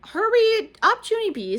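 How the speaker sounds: high, loud and drawn-out hurry; urgent little girl. A narrator's reading. Lucille urging Junie to get dressed, high and loud. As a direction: hurry her along, stretching the words. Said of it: "said Lucille".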